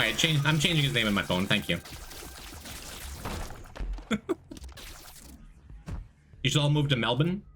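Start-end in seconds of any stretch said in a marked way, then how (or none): 1.8-4.7 breathy laugh. Real, not acted. Laughing at nickname.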